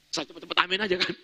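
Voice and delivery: normal talking voice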